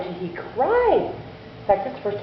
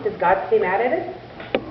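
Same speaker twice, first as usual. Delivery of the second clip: ordinary delivery, nothing marked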